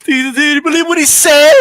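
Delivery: high, loud mock-shocked gossip. Host mocks fans gossiping behind his back, loud and high. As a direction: play a scandalised fan spreading rumours. Mocking fans running around.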